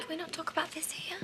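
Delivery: urgent hushed whisper. Real, not acted. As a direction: whisper it so nobody hears. Hushed plea in public.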